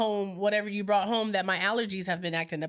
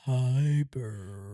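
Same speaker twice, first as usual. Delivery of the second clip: much lower, deep greeting; 9 semitones lower than usual. Acted. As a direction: push the voice way down low. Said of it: "I can get deep".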